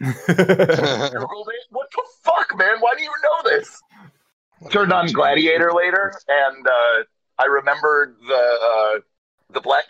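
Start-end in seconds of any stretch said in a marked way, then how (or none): 1.7-3.8 incredulous. Real, not acted. Incredulous outburst at a friend.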